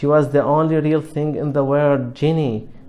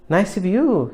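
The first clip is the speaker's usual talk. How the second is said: feeble; 5 semitones higher than usual. Acted. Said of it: "he said feebly".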